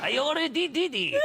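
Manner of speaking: very high, hammed-up Spanish accent on "I already did it"; overdone Spanish detective, laughing. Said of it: "Oh, no, he's Spanish".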